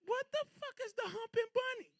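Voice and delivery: high-pitched outraged squeal; outraged disbelief. Real, not acted. Outraged at humping bunny dance.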